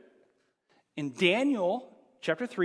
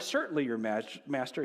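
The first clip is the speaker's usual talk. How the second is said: quiet servant reply; 7 semitones lower, 7 dB quieter, faster than usual. Retold or imitated. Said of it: "They replied".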